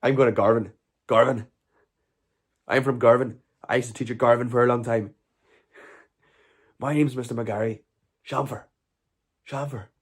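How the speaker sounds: impression of a teacher with a Stranraer accent; Mister McGarry. Streamer imitates his old school teacher, Stranraer accent. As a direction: put on a Stranraer accent, between Northern Irish and Scottish. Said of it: "That was a Stranraer accent".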